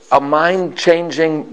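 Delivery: usual speaking voice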